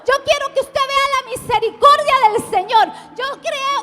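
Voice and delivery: loud, high, driving proclamation; full-force preaching. Real, not acted. Pastor declares God's greatness to the congregation, impassioned preaching. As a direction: preach it to the whole crowd at the top of your voice.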